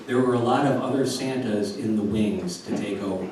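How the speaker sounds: plain reading voice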